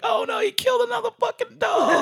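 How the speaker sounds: very high, fast, shouted squeal; mock panicked puppy. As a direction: squeal it in panic like a tiny dog. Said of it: "the puppy was like".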